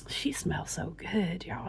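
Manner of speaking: slow whispered delight. Real, not acted. Vlogger sniffing her mugwort oil, whispering. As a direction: breathe it out as if savouring the scent.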